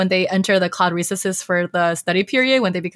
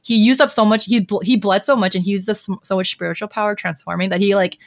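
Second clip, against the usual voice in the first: normal talking voice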